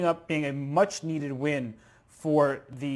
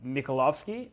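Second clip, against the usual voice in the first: ordinary talk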